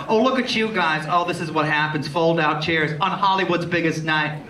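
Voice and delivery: ordinary delivery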